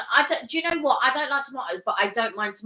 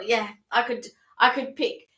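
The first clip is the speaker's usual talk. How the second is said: ordinary delivery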